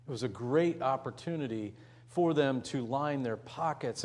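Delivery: ordinary talk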